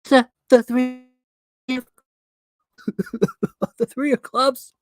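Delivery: high, loud amazed squeal; cried out in amazement. Shown as a demonstration. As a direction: gasp it out, astonished. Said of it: "Oh, she cried".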